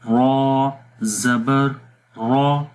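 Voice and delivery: را spelled out then said thick and short; thick ra drill. Shown as a demonstration. Teacher drills ra with zabar for students, thick. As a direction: drill a heavy را, not lengthened. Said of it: "موٹا پڑھیں گے".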